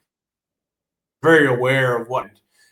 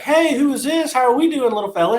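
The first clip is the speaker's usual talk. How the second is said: much higher sing-out greeting; high-pitched camp worker act; cheery camp worker; 10 semitones higher than usual. Acted. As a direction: greet a kid in a high, put-on voice. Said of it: "the worker there is like".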